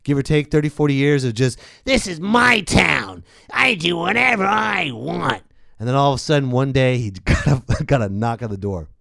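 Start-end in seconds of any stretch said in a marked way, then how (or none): 1.8-5.4 mogul impression. Weinstein voice again.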